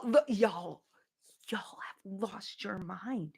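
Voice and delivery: soft unvoiced whisper, slowing down; hushed disbelieving whisper. Real, not acted. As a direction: lean in and whisper it. Whispered aside to chat.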